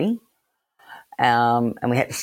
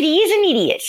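much higher, squeaky mock-indignant protest; 4 dB louder, much faster than usual. To Aaron in chambers, squeaky indignant protest. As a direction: pitch it way up, pleading innocence.

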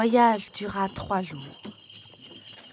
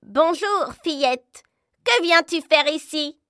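crow voice; 7 semitones higher, 6 dB louder than usual. Acted. Crow speaks to Gerda.

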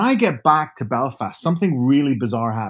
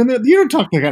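much higher, louder mock child voice; kids protesting; 11 semitones higher, 6 dB louder, much faster than usual. Kids telling their dad to stop the accent, in a high childish voice. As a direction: whine it like a scolding kid. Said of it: "they go".

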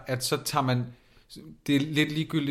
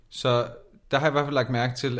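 ordinary talk, nothing marked

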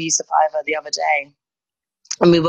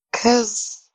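slow, pitched-up matter-of-fact retort; deadpan obvious; 6 semitones higher, slower than usual. Retold or imitated. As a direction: say it like it's obvious. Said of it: "And I'm like".

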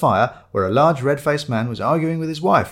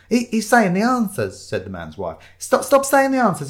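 high, raised-voice, stammered grievance; complaining wife; 10 semitones higher, 3 dB louder than usual. Acted. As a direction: protest loudly that it's unfair. Wife complains about Shiloh spoiling quiz.

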